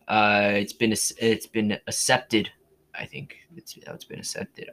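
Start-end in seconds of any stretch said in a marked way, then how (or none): 2.9-4.7 whispering. Real, not acted. Muttered to himself.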